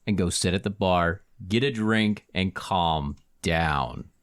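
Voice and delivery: low, firm scolding; stern warning, slowing at the end; fed-up Minotaur bouncer. Acted. Game master plays the Minotaur.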